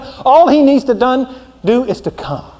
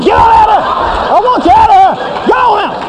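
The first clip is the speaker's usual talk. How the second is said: high, loud shout to get out; God shouting the sinner out of the room; furious shout; 9 semitones higher, 4 dB louder than usual. Acted. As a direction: bellow him out the door. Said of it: "I'm like".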